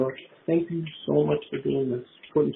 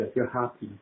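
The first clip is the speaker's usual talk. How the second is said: ordinary talk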